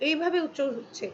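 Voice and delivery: ordinary talk, nothing marked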